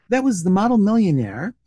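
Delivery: usual speaking voice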